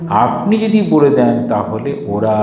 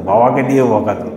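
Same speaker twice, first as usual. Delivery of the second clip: ordinary talk, nothing marked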